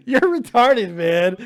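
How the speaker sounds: laughing while talking; words through a laugh. Real, not acted. Laughing at wife's phone fumble.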